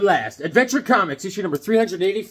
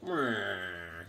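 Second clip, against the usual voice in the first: low, much softer unimpressed grunt; bored meh; 9 semitones lower, 11 dB quieter than usual. Retold or imitated. Legion members reacting to Superboy leaving, deadpan bored. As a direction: shrug it off, totally unimpressed. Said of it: "they're like".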